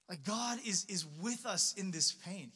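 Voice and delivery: ordinary delivery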